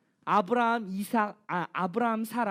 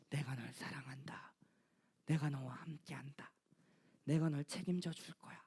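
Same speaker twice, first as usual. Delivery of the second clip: soft unvoiced whisper, much lower and quieter; whispered God's voice; 11 semitones lower, 14 dB quieter than usual. Pastor voicing God to himself, hushed whisper. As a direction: breathe it like a secret promise. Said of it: "계속 찾아오셔서".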